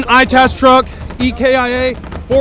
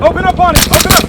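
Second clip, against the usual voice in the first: shouting; 7 semitones higher, 5 dB louder, much faster than usual. Real, not acted. Firefight, ordering fire on trucks.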